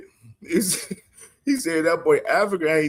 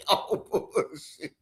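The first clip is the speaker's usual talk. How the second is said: laughing disbelief; 4 semitones higher, 8 dB quieter, slower than usual. Real, not acted. Laughing hard at a picture.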